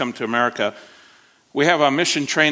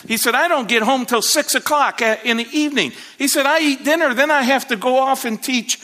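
higher, fervent preaching of the pastor's story; 7 semitones higher than usual. Acted. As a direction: carry the congregation with rising fervour. Retelling the pastor at full preaching.

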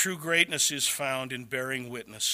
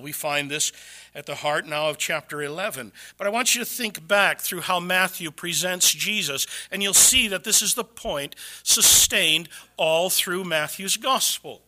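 normal talking voice

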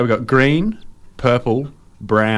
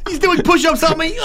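excited yell. Real, not acted. Excited outburst.

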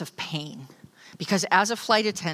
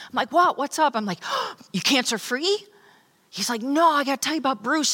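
excited brother; 7 semitones higher than usual. Retold or imitated. Brother Larry telling his sister he found faith, excited. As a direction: gush it out like big news.

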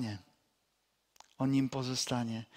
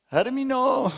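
high, louder sing-song call of a name; calling a sheep; 10 semitones higher, 5 dB louder than usual. Retold or imitated. Preacher imitating the girl calling her sheep, high call. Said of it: "gdyby Klarka przyszła i zawołałaby".

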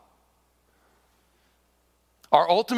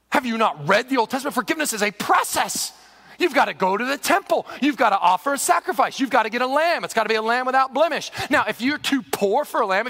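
indignant lecture, higher pitched; outraged scribe; 5 semitones higher, 4 dB louder than usual. Acted. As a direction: list the rules in mounting exasperation. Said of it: "They were outraged".

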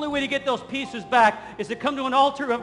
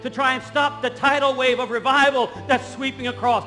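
raised, driving pulpit voice, building to a peak; full-force preaching; 3 semitones higher, 3 dB louder than usual. Acted. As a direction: rouse the congregation with revival fervour.

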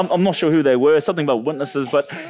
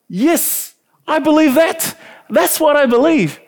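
much higher and louder, eager yes; eager agreement; 12 semitones higher, 4 dB louder, slower than usual. Retold or imitated. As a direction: blurt it out, thrilled to agree. Eagerly agreeing with door visitors.

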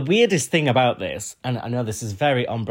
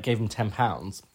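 ordinary delivery

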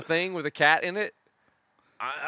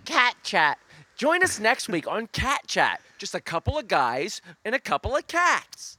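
upbeat host sign-off, a little higher; mock talk-show host closing; show host outro; 6 semitones higher than usual.

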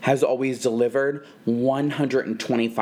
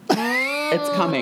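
spooky drawn-out voice; 10 semitones higher, much slower than usual. Acted.

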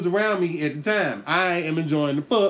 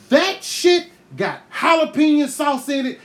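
high, loud burst of outrage; outraged shout; 9 semitones higher, 5 dB louder than usual. Real, not acted. Comic recalling soap burning his throat, outraged shout. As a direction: yell it out in disbelief.

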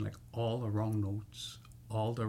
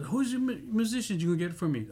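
higher-pitched, quick mock questioning; imitated demanding singer; 8 semitones higher, 3 dB louder, faster than usual. Singer asks promoter about her musicians, imitated high and quick. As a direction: lightly voice an anxious singer's question.

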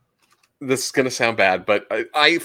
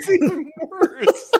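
laughing; slower than usual. Real, not acted. Laughing at petting zoo.

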